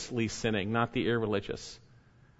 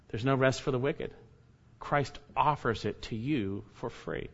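ordinary talk

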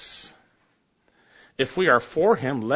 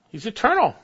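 ordinary talk

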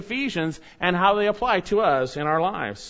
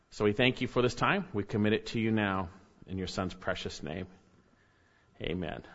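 soft, low closing prayer; 8 semitones lower, 5 dB quieter than usual. Acted. Pastor praying softly over the congregation. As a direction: pray quietly to close.

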